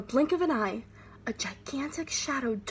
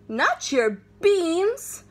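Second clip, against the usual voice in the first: slow, high, indignant protest; be shocked someone took the beans; mock outrage; outraged cry; 7 semitones higher, 4 dB louder, much slower than usual. A narrator's reading. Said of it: "cried Scipito, outraged".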